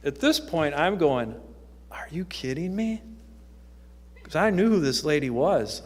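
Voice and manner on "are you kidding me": breathy hushed voice, barely there and rising; hushed disbelief. Retold or imitated. To himself as the teacher thumbs his Bible, hushed disbelief. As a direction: murmur it in stunned private disbelief.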